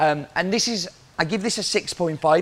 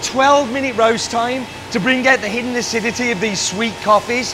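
loud, higher-pitched working voice; raised voice; 7 semitones higher, 6 dB louder than usual. Real, not acted. Roaster explaining his method mid-roast, in a loud raised voice. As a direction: project firmly while busy roasting.

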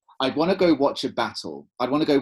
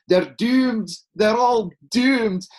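put-on crazy old Scottish doomsayer, high and loud; terrible Scottish accent; 11 semitones higher, 8 dB louder, much slower than usual. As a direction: wail it in a bad Scottish accent, drawn out. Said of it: "doing a terrible Scottish accent".

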